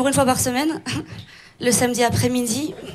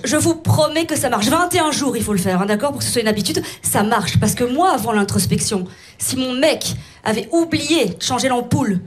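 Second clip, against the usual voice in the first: ordinary delivery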